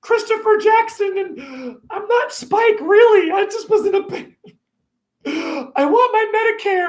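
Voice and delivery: high, far-off put-on voice, way up; high camp voice, slow in spots.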